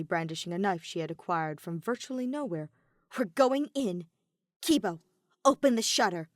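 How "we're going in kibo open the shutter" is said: loud, firm order; commanding. A narrator's reading. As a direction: bark the order at the team. Maki giving orders.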